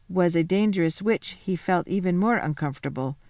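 ordinary delivery, nothing marked

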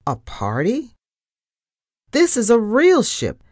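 mildly squeaky, disbelieving objection; squeaked protest. A narrator's reading. Captain objects to a party on his ship, squeaking. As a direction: object in surprise, voice lifting in places. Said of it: "he squeaked".

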